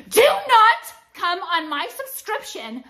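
high, slow emphatic order; yelling. Retold or imitated. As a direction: yell a warning, drawn out. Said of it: "I started yelling at people".

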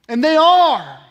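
loud, high, emphatic proclamation; preaching climax. Real, not acted. Preacher proclaiming Paul's words to congregation, full-force preaching. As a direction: proclaim it to the whole church.

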